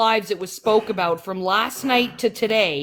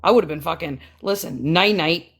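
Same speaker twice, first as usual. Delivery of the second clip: ordinary talk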